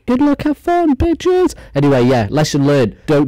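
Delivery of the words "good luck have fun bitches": squeaky high, cheeky farewell; mocking sign-off. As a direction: toss it off in a silly falsetto.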